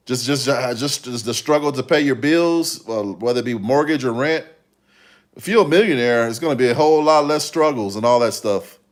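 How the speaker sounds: ordinary talk, nothing marked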